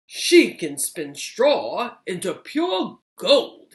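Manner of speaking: loud, slow boasting father; puffed-up miller bragging to the king; boastful miller. Acted. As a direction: brag about her like a show-off dad. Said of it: "he said".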